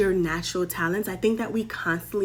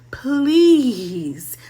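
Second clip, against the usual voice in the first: high, loud, drawn-out plea; hammed-up begging; acted pleading; 4 semitones higher, 7 dB louder than usual. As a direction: beg dramatically, stretching the word. Acting Judah begging for Benji.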